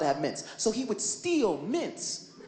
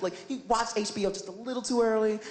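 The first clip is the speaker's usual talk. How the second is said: ordinary talk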